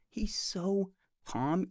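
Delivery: ordinary delivery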